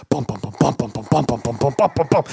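low, booming hummed drum beats; low mock movie drumbeat building tension; imitated drum beat. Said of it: "this drum beat".

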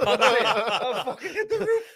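high, slow laughing shout. Real, not acted. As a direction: laugh it out, overjoyed. Overjoyed reliving the goal celebration.

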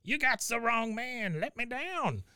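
high, drawn-out put-on protest; mock outraged cry of an arrested man; Cotton. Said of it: "Cotton Hill, you're under arrest for arson".

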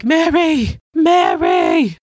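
loud imitation of a desperate call; hammed-up husband yelling for his wife; mock anguished shout. Husband calling his wife after a car crash, mock anguish.

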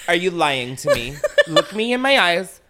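loud, hammed-up questioning with laughter nearby; mock interrogation voice. Host jokingly grilling guests over a lie, mock interrogation. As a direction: play a mock-stern interrogator. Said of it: "do the whole podcast in that voice".

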